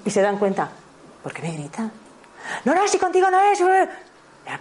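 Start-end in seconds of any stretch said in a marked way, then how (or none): 1.2-1.9 very soft, low-volume question; súper bajito. Acted. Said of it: "les hablo súper bajito". 2.7-4.6 put-on high-pitched agitated child's yell; niño gritando. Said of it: "ya que vienen gritando".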